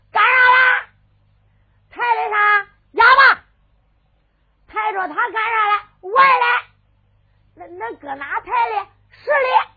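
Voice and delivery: high, loud shouted answers; brash beggar. Acted. Beggars yell back at the servant, high and brash. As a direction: bawl back cheekily.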